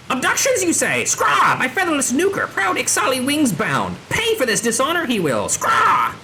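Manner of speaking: high, swooping squawky voice; outraged bird creature. Acted. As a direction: play a bird-person vowing payback.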